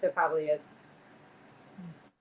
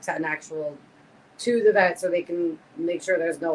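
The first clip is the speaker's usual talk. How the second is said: usual speaking voice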